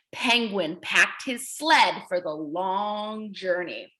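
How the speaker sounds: normal talking voice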